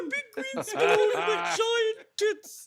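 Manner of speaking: high, slow, loud warble; drawn-out tipsy TV chef; Julia Child voice. Retold or imitated. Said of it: "She's like".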